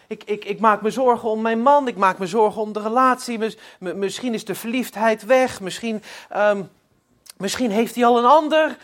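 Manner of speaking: anxious diary entry. Retold or imitated. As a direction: pour the worry out in a rush.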